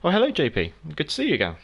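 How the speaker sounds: ordinary delivery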